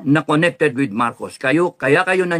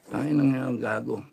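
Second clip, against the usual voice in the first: normal talking voice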